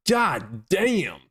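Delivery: hammed-up amazement; mock shock. Mock shock at viewer's height.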